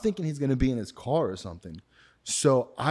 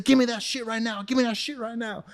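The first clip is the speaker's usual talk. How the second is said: angry demand; 11 semitones higher than usual. Retold or imitated. Dealer demanding the drugs.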